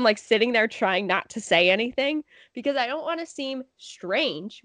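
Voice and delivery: ordinary talk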